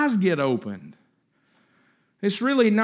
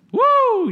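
a sudden high whoop of joy; joyful high woo, much louder; excited woo; 5 dB louder than usual. Real, not acted. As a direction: throw out a high preacher's woo. Preacher's excited cry.

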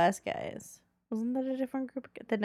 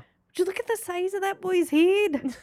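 put-on Scottish accent; 7 semitones higher than usual. Quoting a favourite movie line.

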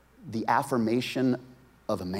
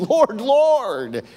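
higher, louder anguished cry of Lord, Lord; emotional plea; 12 semitones higher, 7 dB louder than usual. Retold or imitated. Preacher voices the desperate repeated plea with great emotion. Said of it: "supposed to emphasize great emotion".

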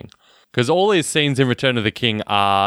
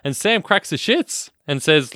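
normal talking voice

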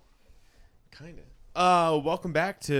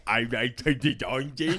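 lightly put-on Australian accent; a hint of Eugene's way of talking. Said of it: "That's how he talks".

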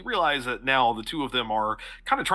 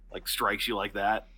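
ordinary talk

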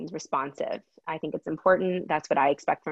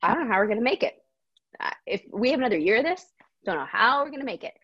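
mock panic; 6 semitones higher, faster than usual. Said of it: "And I'm just like".

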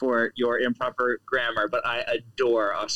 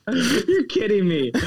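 high, slow, surprised; can't believe that slang is real, slow and high; disbelief; 8 semitones higher than usual. Real, not acted. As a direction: stretch it out in disbelief.

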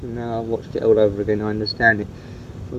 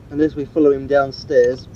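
normal talking voice

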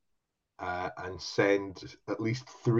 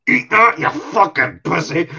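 much higher, much louder gloating shout; triumphant yell; 7 semitones higher, 8 dB louder than usual. Retold or imitated. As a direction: roar it in his face after the shot. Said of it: "And I went".

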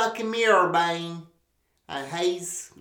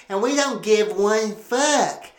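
usual speaking voice

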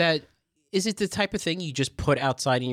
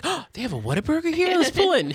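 excited road tripper. Retold or imitated. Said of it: "you're like".

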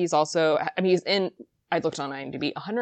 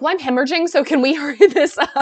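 higher, louder quote dissolving into laughter; laughing mock complaint; 8 semitones higher, 7 dB louder than usual. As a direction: imitate Niles, giggling through it. Quoting Niles in pain.

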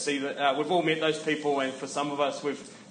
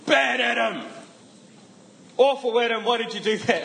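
mock scolding; 6 semitones higher than usual. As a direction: scold like a cross, theatrical parent. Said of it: "God doesn't go".

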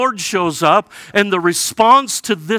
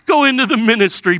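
higher, louder, faster mock; mocking repeat; 6 semitones higher, 4 dB louder, faster than usual. Jeremiah to God, mocking repeat of the call. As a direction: mimic God's call bitterly. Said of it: "mocking repeat".

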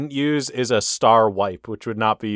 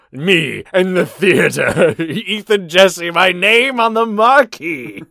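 grandiose; 8 semitones higher, 8 dB louder, slower than usual. Pretend. Daydreams of stardom.

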